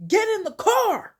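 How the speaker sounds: high, loud acted order; exasperated yell. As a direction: shout at a stubborn parent. Kendrick orders his dad, exasperated.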